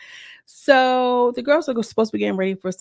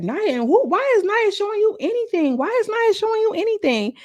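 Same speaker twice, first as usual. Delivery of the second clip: outraged high mock. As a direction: voice pitched way up, outraged question. Quoted outraged question.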